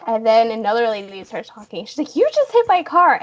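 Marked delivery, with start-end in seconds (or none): 2.2-3.2 high, louder accusation; retold yelling. Second lady arguing back over the car, mock yell. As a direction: imitate an angry driver firing back. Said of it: "I hear her yelling".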